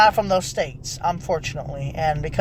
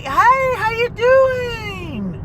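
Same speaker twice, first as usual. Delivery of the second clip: very high, long drawn-out hello; gushing friendly greeting; 14 semitones higher, much slower than usual. Acted. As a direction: stretch out an exaggerated sing-song welcome. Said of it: "so many nice people".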